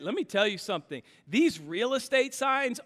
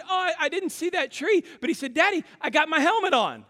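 put-on much higher little boy saying he's fine; child reassuring; 9 semitones higher, 4 dB louder, faster than usual. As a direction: chirp it like a dazed little kid. Son voiced in story.

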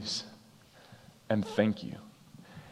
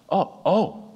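surprised; 9 semitones higher, 4 dB louder than usual. Retold or imitated. Surprised reaction.

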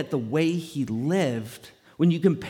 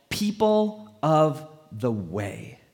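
slow, each word set apart; measured, deliberate naming; emphatic reveal; much slower than usual. Real, not acted. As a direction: announce the title word by word.

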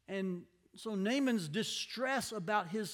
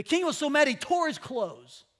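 raised voice; 6 semitones higher, 9 dB louder than usual. Acted. Preacher dramatises king's fury.

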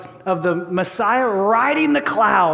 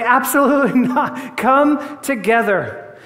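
amused answer; 3 semitones higher, slower than usual. Acted. Preacher answering with a laugh.

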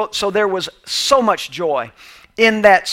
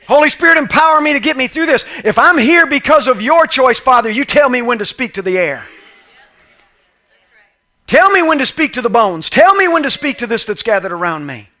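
loud, high fervent preaching prayer; a sample prayer to pray when stuck in the valley; model prayer; 4 semitones higher, 5 dB louder than usual. Acted. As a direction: cry it out to the Father at full force.

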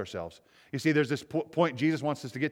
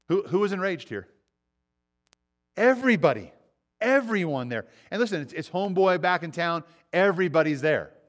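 higher and louder, driving it home; emphatic preaching; 6 semitones higher, 5 dB louder than usual. Real, not acted. Preacher stresses to the congregation that everyone was enraged, loud and high. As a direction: call it out to the whole room.

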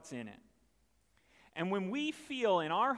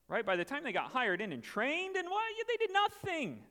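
high outraged whine; indignant complaint; 7 semitones higher than usual. Retold or imitated. Grumbling worker complains to the master, high outraged whine. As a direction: protest like a cheated worker, voice climbing.

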